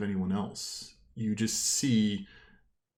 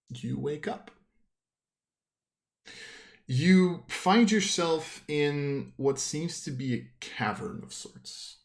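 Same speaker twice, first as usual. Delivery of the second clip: slow hushed scene setting; game master narration; 5 semitones higher, slower than usual. Acted. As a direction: set the scene slowly, drawing them in.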